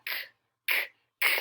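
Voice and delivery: short hard k sounds, no vowel. Shown as a demonstration. Teacher showing children the k sound. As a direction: tap out the k, k, k crisply. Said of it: "begin with the k sound".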